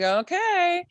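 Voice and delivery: high, sing-song okay; a mock of a resigned girlfriend; lightly voiced high okay. Girlfriend quoted giving up.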